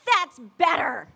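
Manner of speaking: slow, loud, pleased put-down; satisfied coach. Retold or imitated. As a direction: savor the win over him. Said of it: "And she's like".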